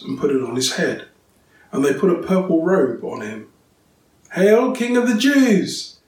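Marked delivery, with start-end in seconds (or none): 4.3-6.0 slow, high, loud mock salute; mocking hail. A narrator's reading. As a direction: jeer it like soldiers mocking. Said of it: "they mocked".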